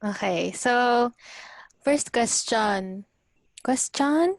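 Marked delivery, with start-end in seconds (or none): 1.8-4.3 louder, higher teasing repeat of question; playful sing-song. Pretend. Host and guest bouncing the word question, playful. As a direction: toss the word back and forth.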